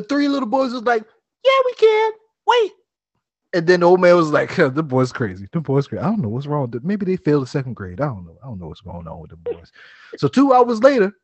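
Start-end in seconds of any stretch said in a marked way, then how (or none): 1.4-2.7 put-on high, cocky kid voice; little boys. Boys defying the old man, high and cocky. 4.5-9.7 put-on low, soft muttering old man; grumbling old man. Old man puzzled about the boys, muttering low.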